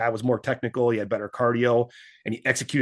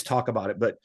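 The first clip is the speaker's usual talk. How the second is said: ordinary talk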